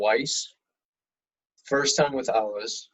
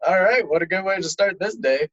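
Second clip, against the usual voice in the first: higher, louder ironic remark; sarcastic cheer, pitched up and louder; mock sarcastic; 8 semitones higher, 8 dB louder than usual. Brother mocking his own bad morning, higher and louder. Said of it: "I was like".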